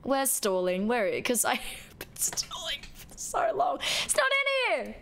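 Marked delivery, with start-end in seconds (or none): 1.2-3.8 unvoiced giggling aside; soft laughing whisper. Real, not acted. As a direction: whisper the joke to yourself.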